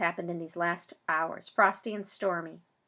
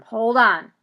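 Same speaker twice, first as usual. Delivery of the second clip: firm call; 3 semitones higher, 13 dB louder than usual. Acted. Doctor stepping between people.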